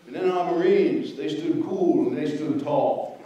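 low, slow Louisiana drawl, lightly imitated; a hint of a Louisiana accent, slow and low; Powell's Louisiana accent. Lecturer quoting a general's praise in a low, slow Louisiana accent. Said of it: "General Powell could say in that Louisiana accent".

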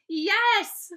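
put-on high, loud, defiant child's shout; shouting back. A narrator's reading. Fudge insists to Mom that he did, shouting back. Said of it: "Fudge shouted back".

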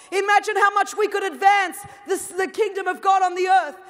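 loud, high, driving sermon voice; an impassioned call to the church; full-force preaching. Acted. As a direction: preach it out to the whole hall.

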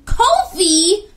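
loud, high call of the name; scared girl yelling at the storyteller; shouting. A narrator's reading. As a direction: yell to stop him. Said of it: "shouted Equia".

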